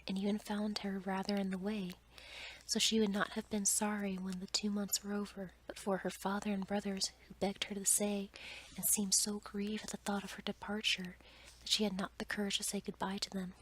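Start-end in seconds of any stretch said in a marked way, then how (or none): none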